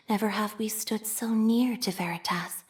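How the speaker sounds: devout whisper. Acted. Pious whisper telling the faithful Veritas is near. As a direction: murmur it like scripture.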